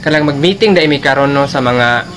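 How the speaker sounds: a loud student and teacher in a classroom; loud classroom skit. Acted. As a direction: play both the late student and the teacher, loud. Plays a schoolroom scene with teacher.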